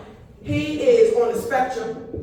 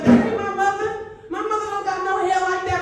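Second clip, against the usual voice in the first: put-on kid's voice pitched up, a bit louder; high kid voice; 8 semitones higher, 4 dB louder than usual. Grandson in the cop car telling the cop he's not her son, high indignant voice. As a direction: squeak it out like an indignant little boy.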